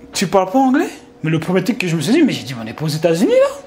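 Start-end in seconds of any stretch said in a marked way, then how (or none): 0.1-0.9 incredulous thought. Retold or imitated.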